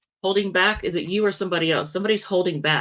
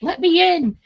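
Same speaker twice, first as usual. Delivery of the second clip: high, loud whiny plea voicing the cat; mock cat voice; 11 semitones higher, 6 dB louder than usual. Grumpy cat demanding to be let in, high loud mock whine. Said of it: "She's all mad. She said".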